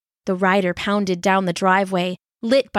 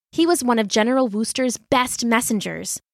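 usual speaking voice

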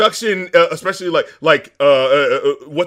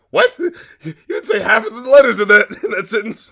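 laughing disbelief; 7 semitones higher, 5 dB louder than usual. Retold or imitated. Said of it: "I'm like".

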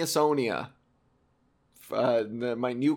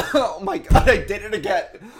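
real choked, strained gasp, higher and louder; choking after a cough; 5 semitones higher, 6 dB louder than usual. Real, not acted. Vlogger choking on spit mid-video, real choked gasp. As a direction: fight through the cough to speak. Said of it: "give me the Heimlich".

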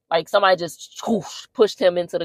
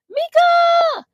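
shrieked name, far higher and louder; a possessed woman screaming her husband's name; demonic scream; 4 dB louder than usual. Retold or imitated. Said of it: "the scream sounds very demonic".

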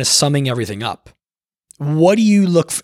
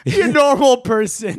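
laughing, much higher-pitched echo; amused echo; 10 semitones higher than usual. Real, not acted. Co-host teases the other about living without Wi-Fi lights, amused echo. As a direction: repeat it back high, through a laugh.

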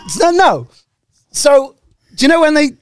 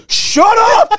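higher-pitched mock "shut up" yell; mock shout; 10 semitones higher than usual. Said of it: "them people that go".

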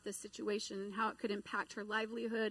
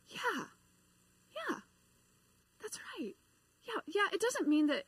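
excited; 9 semitones higher than usual. Retold or imitated. Colleague agreeing after the prayer, excitedly high. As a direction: let the excitement lift the pitch high. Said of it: "She gets all excited".

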